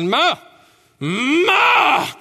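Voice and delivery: put-on high demanding toddler cry; toddler yelling. Preacher mimicking niece screaming for food. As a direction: wail for food like an impatient baby.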